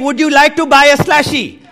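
mocking a bully's fake Indian accent. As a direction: imitate the classmate's taunting Indian accent. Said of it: "he starts talking in this Indian accent".

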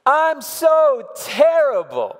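put-on high, slow, loud wail of a frustrated child; child's scream. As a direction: cry it out like a kid fed up after a fall. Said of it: "she just screams".

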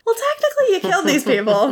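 mocking retort. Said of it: "it's like".

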